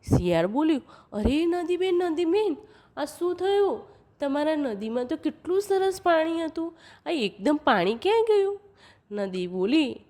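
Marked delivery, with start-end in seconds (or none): 1.1-8.6 high-pitched, astonished jackal; puzzled jackal. Acted. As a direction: play a jackal wondering aloud, pitched up. Jackal questions the river.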